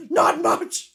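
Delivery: high, loud yell at a voice assistant; shouting. Retold or imitated. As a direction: imitate yourself shouting a command. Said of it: "times of me shouting".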